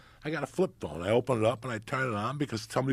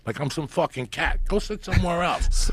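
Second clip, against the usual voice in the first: raised, heated comic argument; indignant retort; 7 semitones higher, 4 dB louder than usual. Acted. Arguing back at the man who took his seat, indignant. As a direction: argue back loudly, pitch climbing to the end. Acted quarrel with the seat taker.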